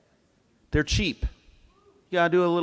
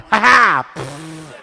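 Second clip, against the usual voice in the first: gloating laugh; 2 semitones higher, 9 dB louder, much slower than usual. Retold or imitated. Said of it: "and I went".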